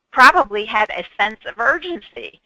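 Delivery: ordinary talk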